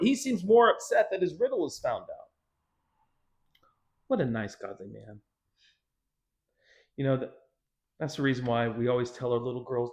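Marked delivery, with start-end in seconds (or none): none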